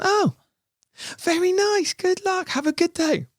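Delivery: very high, cheery mock woman's voice; woman passer-by. Dog walker wishes the men luck, high mock voice. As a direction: chirp it high like a pleased passer-by. Retold woman's pleased farewell.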